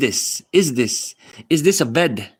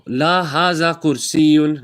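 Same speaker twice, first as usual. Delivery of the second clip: slow teaching; slower than usual. Shown as a demonstration.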